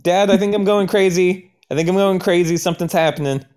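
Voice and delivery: high-pitched, frightened plea to dad; panicked call to dad. Acted. Scared son tells dad he is going crazy, high and panicked. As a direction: sound like you're losing your mind.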